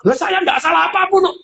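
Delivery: a huffy defensive denial; defensive wrong tone. Shown as a demonstration. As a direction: sound offended and self-righteous. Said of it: "Nadanya sudah begitu".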